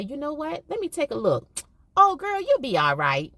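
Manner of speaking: put-on cheerful reassurance to a little girl; soothing teacher. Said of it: "And I say".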